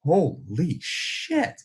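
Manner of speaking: drawn out very slowly, breathless awe; shaken holy shit. Retold or imitated. Remembering the traffic stop, very slow shaken awe. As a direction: stretch it out in shock. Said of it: "clenching in my chest going".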